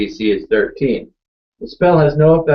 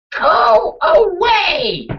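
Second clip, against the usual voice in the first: high, drawn-out shout; yelling at the Kraken; 4 dB louder, much slower than usual. Acted. Player's character commanding the Kraken to leave, long high yell. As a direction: yell it out, stretching each word. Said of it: "yell at the Kraken".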